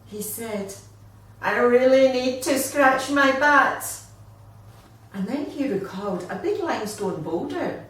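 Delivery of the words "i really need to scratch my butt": loud, raised desperate plea; urgent itchy wombat. Acted. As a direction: beg to scratch your itch.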